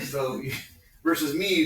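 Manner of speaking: normal talking voice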